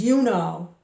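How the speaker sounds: loud, accusing retort; pointed accusation. Retold or imitated. Street worker answering what did I do, loudly accusing. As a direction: throw it back loudly as an imitated accusation. Acting out the menacing man's answer.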